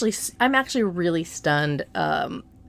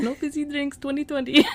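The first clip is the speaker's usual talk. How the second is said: imitated catchphrase; 7 semitones higher than usual. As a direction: imitate his slogan in a raised voice. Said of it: "That's his catchphrase".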